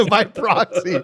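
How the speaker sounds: slow, laughing "By proxy." with a laugh on it. Real, not acted. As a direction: echo "By proxy." through a laugh. Players laugh at joke.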